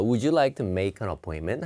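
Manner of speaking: "would you like some ice cream?" said slowly with a rise at the end; slow example sentence. Shown as a demonstration. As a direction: read the offer slowly, rising at cream. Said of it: "앞부분을 잘 드셔야 됩니다". (천천히 끝을 올려 읽는 예문)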